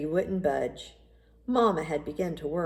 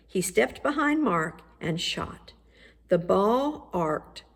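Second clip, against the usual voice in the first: ordinary delivery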